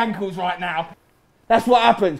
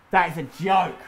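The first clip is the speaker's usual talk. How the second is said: ordinary delivery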